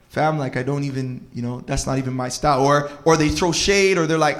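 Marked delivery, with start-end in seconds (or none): 0.1-2.7 low, quiet brush-off; unimpressed reaction. Retold or imitated. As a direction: play an underwhelmed gift receiver, softly. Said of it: "and then they're like".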